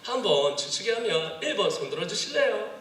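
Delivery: high, sing-song Gyeongsang dialect; playfully poll them in Gyeongsang accent; swooping high Gyeongsang intonation. Shown as a demonstration. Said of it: "경상도 사람 같으면".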